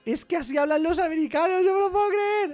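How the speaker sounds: high, loud disbelief; can't believe what he hears; incredulous. Real, not acted. Coder to viewers, high, loud and incredulous.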